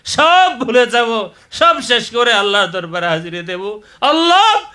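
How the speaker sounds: high, very loud fervent cry to God; full-force preaching. Real, not acted. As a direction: build to an impassioned shout.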